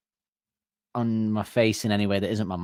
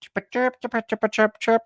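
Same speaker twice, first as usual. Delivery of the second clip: very high bird chirps; very high, playful chirping; silly chirping; 12 semitones higher than usual. Shown as a demonstration. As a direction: chirp to dodge the boss. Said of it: "I'm just gonna go".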